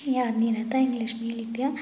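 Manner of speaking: muttered under the breath; half to herself while writing; whispered aside. Real, not acted. Whisper it as an aside. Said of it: "whispered aside".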